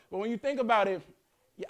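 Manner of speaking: ordinary talk, nothing marked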